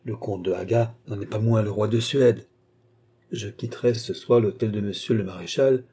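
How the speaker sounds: ordinary delivery, nothing marked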